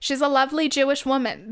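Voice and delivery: Long Island accent. Shown as a demonstration. Signing off as a Long Island mom, hammed-up accent. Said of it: "what a Long Island mom sounds like".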